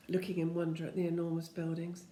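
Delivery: ordinary delivery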